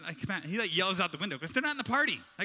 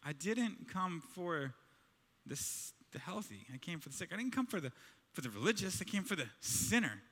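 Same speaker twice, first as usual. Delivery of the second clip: softly spoken Jesus; 4 semitones lower, 9 dB quieter than usual. Retold or imitated. Jesus at the table, quietly answering the religious. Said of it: "and he's like".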